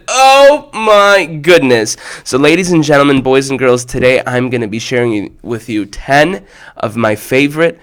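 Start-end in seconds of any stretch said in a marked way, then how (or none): 0.1-2.0 a long, high, amazed oh; gedehntes Staunen. Real, not acted. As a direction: stretch the oh out high and slow in delight. Host reacts with drawn-out delight.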